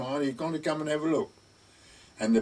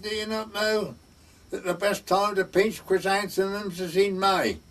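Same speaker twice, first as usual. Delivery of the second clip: put-on higher-pitched Welsh-accented instruction; Evans; 6 semitones higher than usual. Welsh parson advising on chrysanthemums, Welsh accent. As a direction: imitate a Welsh parson giving gardening advice. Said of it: "in his Welsh voice".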